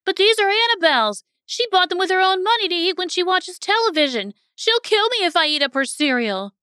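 put-on high, worried little-kid voice; anxious little boy. A narrator's reading. Little brother afraid to eat his sister's cereal, anxious high voice. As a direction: protest anxiously in a small child's high pitch. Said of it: "he said anxiously".

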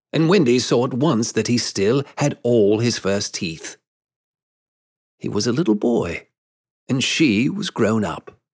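plain reading voice

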